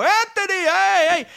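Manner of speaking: high, loud street greeting; shopkeepers calling out. Retold or imitated. Shopkeepers hail the boy in the street, high calls. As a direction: hail the boy from the shop door. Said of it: "shopkeepers would go".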